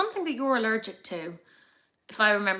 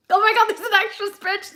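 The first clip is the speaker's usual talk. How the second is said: much higher, louder, thrilled; gleeful outburst over a spreadsheet; excited squeal; 11 semitones higher, 7 dB louder than usual. Real, not acted. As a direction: burst out with real delight. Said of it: "gotten really weirdly emotional".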